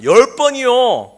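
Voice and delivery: put-on a child shouting out his answer in class; eager child. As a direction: answer like a child sure of himself. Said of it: "어떤 아이들이 이야기합니다".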